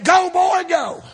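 excited urging. Retold or imitated. Retold pastor urging during fishing.

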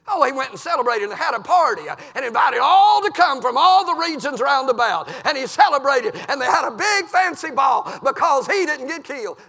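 loud, high preaching holler; full-force revival preaching. Acted. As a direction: hammer it home from the pulpit.

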